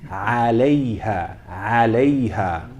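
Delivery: عليها, opening Ain pressed from the throat; عليها with a clear Ain. Shown as a demonstration. Teacher modelling عليها for the class, clear Ain. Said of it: "now comes our friend Ain".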